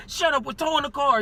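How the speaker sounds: imitated officer's yelled order; retold yelling. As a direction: cut someone off, imitating an angry officer. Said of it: "He just started yelling at me".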